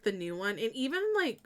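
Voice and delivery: normal talking voice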